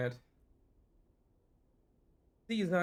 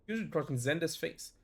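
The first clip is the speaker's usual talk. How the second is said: usual speaking voice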